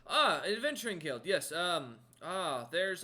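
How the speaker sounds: light up as it comes back to you; crewman recalling. Acted. Crewman remembering an adventuring guild for the stranger, loud recognition. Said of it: "takes a minute and goes".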